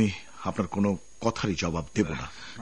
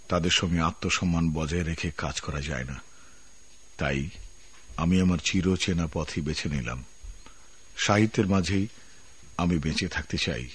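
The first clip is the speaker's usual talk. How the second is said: letter voiceover. Acted. Resignation letter read in voice.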